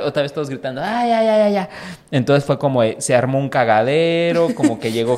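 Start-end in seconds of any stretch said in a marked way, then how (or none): 0.8-1.7 mock scream. Todos gritando de miedo.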